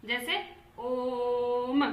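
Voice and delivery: ओम stretched out long and slow; chant-like ओम; drawn-out Om. Shown as a demonstration. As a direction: hold the ओम three times as long as a short vowel. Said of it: "इसका उच्चारण करेंगे कैसे".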